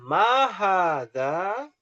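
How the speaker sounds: slow, drawn-out question; slow model question. Shown as a demonstration. Teacher drills the Arabic question slowly. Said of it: "ada gambar rumah".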